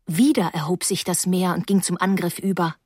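ordinary delivery, nothing marked

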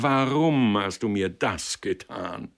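slow, anguished reproach; hurt outcry, drawn slowly; bursting out. A narrator's reading. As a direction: wail the question, stretched out. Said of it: "rief er ausbrechend".

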